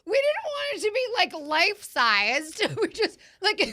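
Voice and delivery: laughing protest, half yelling; shocked laughing outburst. Real, not acted. Reacting to a drool face.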